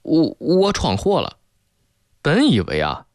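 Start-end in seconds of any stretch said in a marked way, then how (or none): 0.0-1.4 halting, slow, higher-pitched admission; frightened confession. A narrator's reading. Girlfriend owning up to boyfriend after spilling water, frightened and halting. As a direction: narrate a mildly panicked, stammered confession. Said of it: "他就惊恐地回答道".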